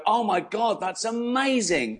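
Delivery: high, drawn-out retold amazement; amazed awe. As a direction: act out wide-eyed wonder, slow and high. Acts out his amazement at diving.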